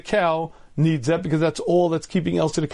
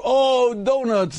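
mock longing moan, pitched up; retold moan over donuts; 6 semitones higher, much slower than usual. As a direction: moan for the donuts like a craving dieter. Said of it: "the moans go out".

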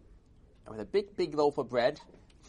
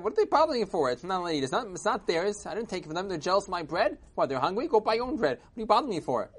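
higher-pitched aggrieved protest; indignant complaint; 7 semitones higher, 4 dB louder, faster than usual. Acted. Old man complains to the Rav, indignant. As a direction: complain as if wrongly picked on.

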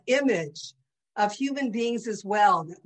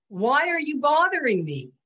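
higher, louder mock-annoyed rabbi; brusque greeting; 7 semitones higher, 5 dB louder than usual. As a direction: retell it as a loud, brusque challenge. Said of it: "he greeted me with the words".

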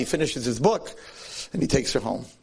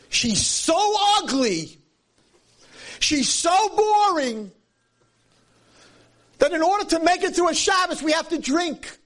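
much higher and very slow, hammed-up scorn; mocking lament; slower than usual. Preacher voices the people complaining about Shabbos, mocking and drawn-out. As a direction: preach it like a sneering complaint, dragging every word.

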